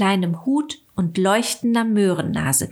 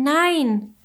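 higher cry of no, falling away; 8 semitones higher than usual. A narrator's reading. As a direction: call no in despair, voice dropping. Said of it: "rief Willi da".

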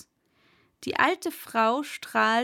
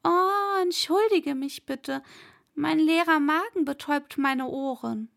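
high-pitched, innocent-sounding apology; unschuldig tuende Fatima; 5 semitones higher than usual. Acted. (hohe, gespielt reumütige Stimme)

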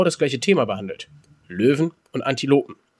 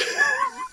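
real laugh; 4 dB quieter than usual. Real, not acted. Laughing at the podcast coincidence, burst of high laughter.

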